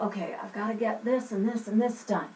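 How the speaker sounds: quiet self-talk in a different voice; talking to yourself. Shown as a demonstration. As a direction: mumble it under your breath like a private to-do list. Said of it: "are you talking just to yourself".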